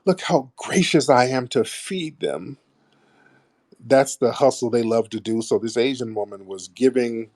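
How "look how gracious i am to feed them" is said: higher, put-on self-congratulating do-gooder voice; mock saintly benefactor. Mocking celebrities who visit poor villages, as a self-important saviour. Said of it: "act like Mama Jesus".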